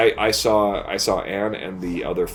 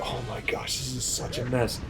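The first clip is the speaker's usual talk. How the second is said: breathy whispered dismay; whispered oh my gosh at the mess; exasperated whisper; 2 semitones higher, 10 dB quieter than usual. Retold or imitated. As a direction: whisper it like you can't believe the mess. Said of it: "I'm just like".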